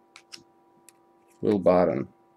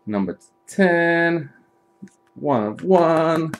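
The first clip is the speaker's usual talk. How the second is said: slow proud reveal; 6 semitones higher, much slower than usual. Real, not acted. Announcing the box hits.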